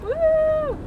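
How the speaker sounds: high, loud woo; excited cheer. Real, not acted. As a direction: cheer as you splash through. Cheering on water crossing.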